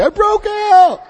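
high, loud tough-guy boast like a Muggsy gangster. Retold or imitated. Telling his mother he escaped jail, loud high gangster crow. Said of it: "Like a Muggsy".

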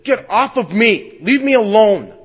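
loud, high, slow rebuke; angry yell. Retold or imitated. Self-absorbed dad yelling at daughter on his lap, angry. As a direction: push the child away with the voice.

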